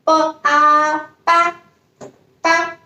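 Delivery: ba held high and level; first tone ba. Shown as a demonstration. Teacher drilling first tone syllables for students. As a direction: keep ba high and flat. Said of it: "pronounce it with the first tone".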